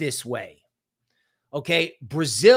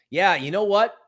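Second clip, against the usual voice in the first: ordinary talk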